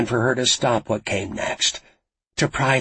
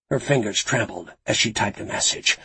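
usual speaking voice

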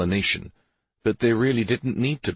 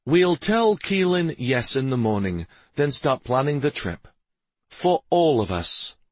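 lightly voiced husband, pitched higher; decided, fond husband; 7 semitones higher than usual. A narrator's reading. Father telling his family they will all travel, decided. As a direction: announce the family plan with gentle resolve.

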